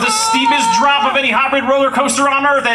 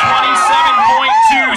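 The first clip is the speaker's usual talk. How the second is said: much higher, louder shouted record reveal; bigger hyped reveal; 3 dB louder than usual. Acted. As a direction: top the first take, go way bigger. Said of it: "But that's probably not good enough".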